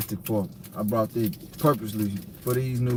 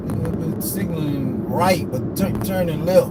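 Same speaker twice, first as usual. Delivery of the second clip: annoyed yell; 5 semitones higher, 4 dB louder than usual. Real, not acted. Yelling at a driver.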